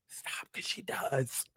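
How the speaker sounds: barely-there, slow whisper; whispered aside. Real, not acted. Host agreeing with her own roast, whispered aside. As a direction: whisper it slowly, as if helplessly amused.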